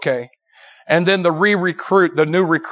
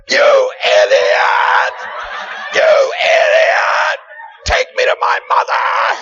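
loud, drawn-out acted shriek; furious wife; 5 dB louder, much slower than usual. Wife to husband after the plunge, furious shrieking. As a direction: scream each word slowly, in outrage. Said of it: "And she's like".

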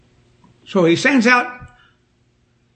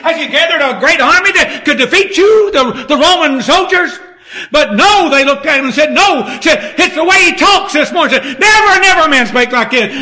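loud, high-pitched sermon delivery; full-force preaching; 7 semitones higher, 4 dB louder than usual. Real, not acted. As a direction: preach it out hard to the congregation.

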